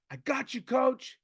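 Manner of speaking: high, loud shout back to the coach; imitated call-back. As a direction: imitate a player calling across the field. Player calls back to coach.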